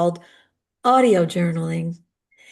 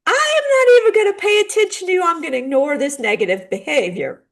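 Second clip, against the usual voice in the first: dismissive parent; 7 semitones higher, faster than usual. Retold or imitated. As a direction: play the parent who refuses to listen. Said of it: "And, or".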